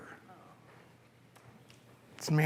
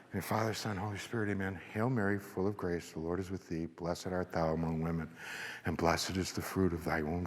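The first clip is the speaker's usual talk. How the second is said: softer, steady prayer recitation; recited prayer; 5 semitones lower, 7 dB quieter than usual. Acted. Priest-like speaker leading the mass in a soft prayer. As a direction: lead the congregation gently through the Hail Mary. Leads the Hail Mary at mass.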